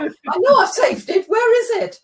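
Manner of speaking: high, loud frantic thought; a burst of panic at a lost file; panicked inner voice. Retold or imitated. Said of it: "And I thought".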